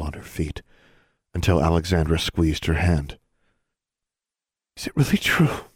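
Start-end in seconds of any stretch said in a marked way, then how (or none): 4.8-5.7 put-on high, breathless girl's voice. A narrator's reading. Girl hearing her father is released, breathless. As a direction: gasp the question out, pitched high. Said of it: "Anna asked breathlessly".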